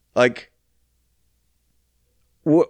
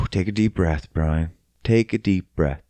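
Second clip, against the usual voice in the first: calming himself; 9 semitones lower, 4 dB quieter than usual. Real, not acted. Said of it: "I get so worked up".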